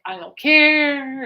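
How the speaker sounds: slow, loud, drawn-out don't care; sarcastic. Retold or imitated. Alice dismissing her band-name idea with loud sarcasm. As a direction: stretch it out, flatly dismissive. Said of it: "he's like".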